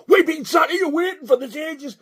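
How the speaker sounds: high-pitched yelled protest; retold angry shout. Companion protesting the long wait, imitated angry shout.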